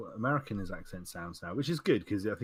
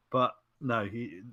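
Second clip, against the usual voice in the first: ordinary delivery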